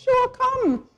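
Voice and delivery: lightly imitated male mentor saying yes. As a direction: quote him high and eager. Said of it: "and he said".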